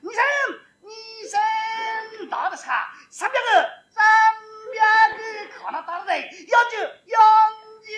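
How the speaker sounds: high, loud call with long pauses; slow ringing lottery call; announcer's call. Acted. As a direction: spell out the number to the crowd. Caller reads the number digit by digit.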